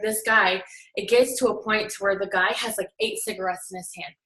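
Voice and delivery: ordinary talk, nothing marked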